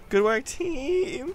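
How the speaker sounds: high, slow, wavering whimper; mock whimper. Gamer thanking teammates while pretending to cry, mock whimper. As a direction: start to break into fake tears. Fake praise turning to crying.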